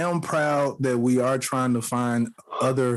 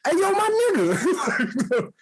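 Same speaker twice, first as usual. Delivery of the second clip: mock New York woman; slower than usual. Mocking how New York women greet men, slow with a laugh. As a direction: drawl it out through a laugh. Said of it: "when the women be like".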